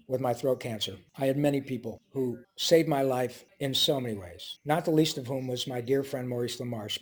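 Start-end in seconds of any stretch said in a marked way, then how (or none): none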